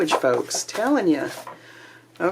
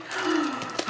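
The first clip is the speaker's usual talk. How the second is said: excited gasp; 10 semitones higher, 6 dB quieter than usual. Real, not acted. Delighted gasp at the paper.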